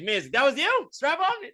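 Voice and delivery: very high, incredulous rising question; mock comedian jab. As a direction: act out the headliner's fake surprise. Headliner mock-asking the crowd.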